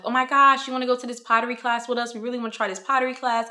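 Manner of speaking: fast, high-pitched excited invite; eager coworker. Acted. Coworker inviting a colleague to pottery class, quick and excited. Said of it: "your coworkers are like".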